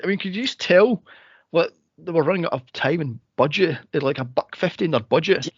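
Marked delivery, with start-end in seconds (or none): none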